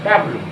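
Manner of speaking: ডাব্লু merged into "dab-lu"; common mispronunciation. Shown as a demonstration. Teacher recalling the primary-school version, merged ডাব্লু. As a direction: run ডাব্লু together as one short word. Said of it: "ওরা প্রাইমারিতে শিখেছে".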